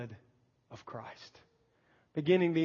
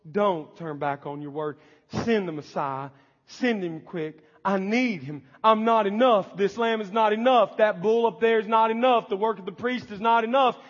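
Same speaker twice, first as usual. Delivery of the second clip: a believer begging God, louder with each not enough; desperate pleading prayer; 5 semitones higher, 6 dB louder than usual. Acted. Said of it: "that's the heart of a believer".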